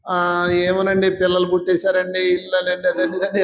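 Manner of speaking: droning monotone, words dragged out at the start; listless sing-along list of excuses on one note; mock excuse-making. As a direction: imitate someone reeling off lame excuses. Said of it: "ఏదో కారణాలు చెప్తావు".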